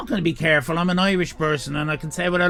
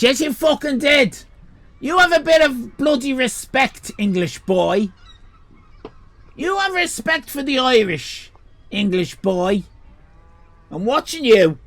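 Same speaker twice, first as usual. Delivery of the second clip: higher, louder hammed-up Irish rage; mock furious rant; 7 semitones higher, 4 dB louder than usual. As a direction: tear into the English boy, half joking. Streamer berates English viewer.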